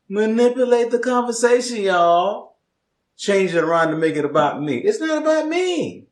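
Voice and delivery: high protest, leaping up at the end; mock defensiveness. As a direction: play the defensive one, voice climbing very high. Mock self-defence about the argument.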